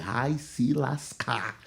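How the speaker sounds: very slow, drawn-out muttered curse; inward cursing. Retold or imitated. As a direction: stretch each word in private irritation. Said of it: "por dentro eu falei".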